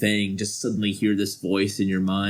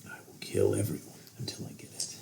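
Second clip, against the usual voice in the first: fast, softer chilling whisper; menacing whisper; 11 dB quieter, faster than usual. Acted. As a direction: threaten in a hushed voice. Villain answers in whisper.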